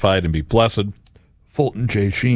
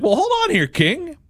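high, indignant "hold on here, king"; mock objection. Questioning the king's judgment, mock indignant. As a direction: push back at the king, half-joking. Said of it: "I'm like".

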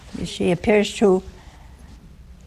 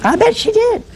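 much higher and louder with a laugh; gleeful; 14 semitones higher, 5 dB louder than usual. Real, not acted. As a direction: burst out in delighted agreement. Laughing over Elizabeth's relief.

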